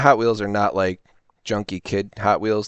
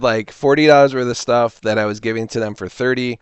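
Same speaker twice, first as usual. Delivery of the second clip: ordinary talk, nothing marked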